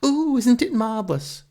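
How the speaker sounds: high, loud gushing tourist coo. Acted. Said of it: "All these tourists from Surrey, they all cheer".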